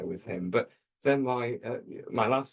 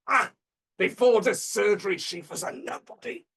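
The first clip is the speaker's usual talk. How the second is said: high, loud mad-scientist proclamation; mad professor; 10 semitones higher, 4 dB louder than usual. Retold or imitated. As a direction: declaim it as a crazed European surgeon, pitched way up. Mad professor boasting of surgery.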